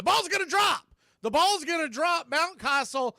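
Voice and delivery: high, loud, rising excitement as the ball falls; excited call. Real, not acted. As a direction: yell it out as the catch is blown. Play-by-play call, ball dropping.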